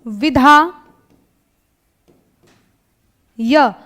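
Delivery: विधा and य said as separate slow chunks with long pauses; split into parts. Shown as a demonstration. Teacher writes the word on the board, slowly in pieces. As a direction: break the word apart while writing it. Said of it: "अब य के ऊपर ह्रस्व ई की मात्रा चढ़ा दो".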